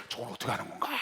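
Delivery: low, soft wondering to oneself; puzzled musing. Retold or imitated. Trainee wondering how the president does it, quiet musing. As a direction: ask it under your breath. Retold puzzled thought to himself.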